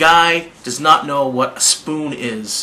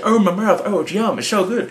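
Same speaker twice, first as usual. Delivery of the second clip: higher, lightly imitated Australian voice; playful mock-Australian accent, pitched up; Australian accent impression; 7 semitones higher than usual. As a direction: mimic an Australian chili eater relishing the burn. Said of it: "he's got an Australian accent".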